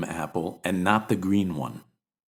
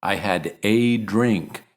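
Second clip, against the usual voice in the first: "a" said as long "ay" and stressed, slow; slower than usual. Shown as a demonstration. Husband assuring his wife, stressing "a" slowly. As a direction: lean on "a" in "I had a drink". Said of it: "you stress both the indefinite article".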